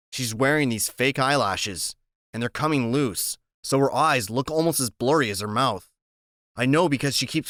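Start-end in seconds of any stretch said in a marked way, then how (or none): none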